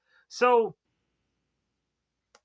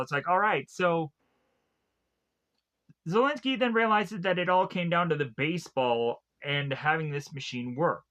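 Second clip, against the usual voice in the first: usual speaking voice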